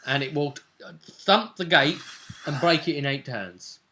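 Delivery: ordinary talk, nothing marked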